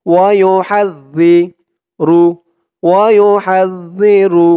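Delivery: slow, drawn-out Quranic chant; tajweed recitation. Shown as a demonstration. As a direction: recite it slowly with long held vowels. (ধীরে টেনে কুরআন তিলাওয়াত)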